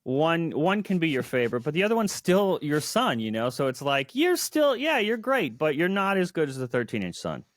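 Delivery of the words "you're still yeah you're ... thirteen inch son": high-pitched placating parent voice; parent consoling child. Pretend. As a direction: reassure the lesser child in a high, playful voice. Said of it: "if you have two children".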